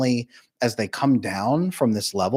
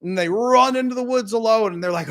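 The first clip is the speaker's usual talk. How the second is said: ordinary talk, nothing marked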